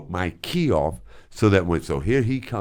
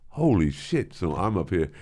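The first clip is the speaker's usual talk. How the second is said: ordinary delivery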